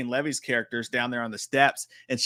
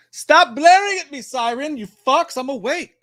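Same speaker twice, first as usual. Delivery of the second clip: mock angry yell; 13 semitones higher, 10 dB louder than usual. Streamer yelling at a blaring trailer siren, hammed-up fury. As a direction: yell much higher and louder at the siren.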